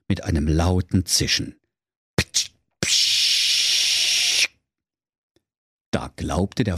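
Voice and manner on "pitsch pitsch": hiss. Acted. Door hisses open.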